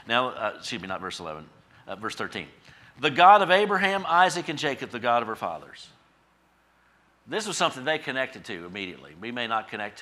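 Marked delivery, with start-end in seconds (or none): 3.0-5.9 loud, raised start settling as it goes; forceful scripture reading. Real, not acted. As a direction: proclaim the verse to the congregation. Preaches scripture at full force.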